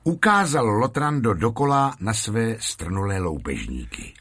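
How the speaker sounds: ordinary delivery, nothing marked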